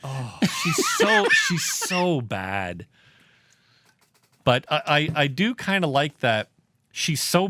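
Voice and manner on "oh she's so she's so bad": drawn-out groan. Real, not acted. Mock groan at bad actor.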